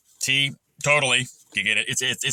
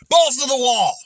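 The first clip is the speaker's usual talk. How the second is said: shout; 10 semitones higher, 7 dB louder than usual. Real, not acted. Shouting a catchphrase.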